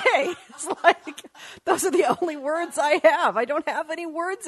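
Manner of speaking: high, loud and laughing at his wits' end; helpless high laughing; laughing exasperation. Real, not acted. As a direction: laugh through it, out of words. Laughing despair over politics.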